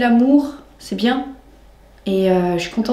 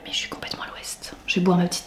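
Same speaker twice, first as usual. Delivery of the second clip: hushed, much softer whisper; 3 semitones lower, 10 dB quieter, faster than usual. Real, not acted. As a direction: whisper it, worn out and sleepy. Tired, winding down for the evening.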